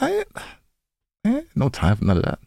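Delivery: normal talking voice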